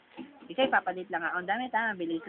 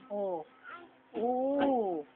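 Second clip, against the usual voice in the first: normal talking voice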